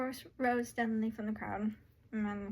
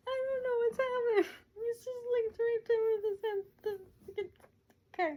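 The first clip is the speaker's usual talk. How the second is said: high-pitched fussing; 12 semitones higher, 4 dB louder than usual. Real, not acted. Reader puzzled by him draped with his head, high fussing. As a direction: fuss in a much higher voice.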